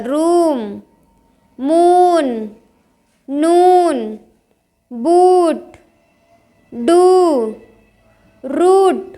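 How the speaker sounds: रूम, मून, डू with a stretched "oo"; long oo. Shown as a demonstration. Teacher drilling double-o words, रूम and मून with long "oo". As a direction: draw out the "oo" in नून and बूट. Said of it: "यहाँ पे बड़ा ऊ".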